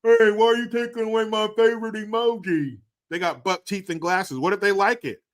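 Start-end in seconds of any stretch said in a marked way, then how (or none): 0.0-2.8 high, mocking complainer; whiny offended voice. Mocking an offended emoji user, whiny voice. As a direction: whine it like someone offended.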